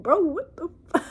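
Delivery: high-pitched disbelief; amused, baffled outburst; incredulous reaction. Retold or imitated. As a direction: say it high, about to laugh. Incredulous reaction to cult story.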